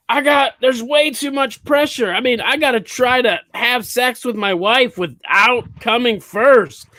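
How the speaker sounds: high, exasperated complaining voice; whiny stressed man. Retold or imitated. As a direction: whine it like a stressed husband.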